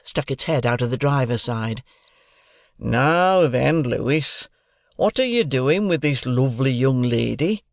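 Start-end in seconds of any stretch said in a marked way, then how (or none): 2.8-7.6 put-on hearty broad Yorkshire accent; cheery Yorkshire greeting, broad accent. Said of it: "spoke with a broad Yorkshire accent".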